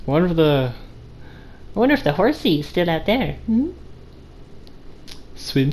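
ordinary talk, nothing marked

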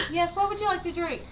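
high-pitched imitated order taker; server in a video. Retelling a video to friends, imitated woman server. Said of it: "And she's like".

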